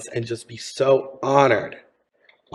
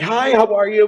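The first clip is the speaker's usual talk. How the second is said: high, louder gushing greeting; giddy, bubbly hello, pitched way up; 9 semitones higher, 5 dB louder than usual. Retold or imitated. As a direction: imitate an eager fan bounding over to say hi. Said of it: "Very, like, bubbly and giddy".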